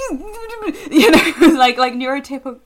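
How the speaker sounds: high, loud laughing speech; words bursting through laughter. Real, not acted. Laughing while describing joy.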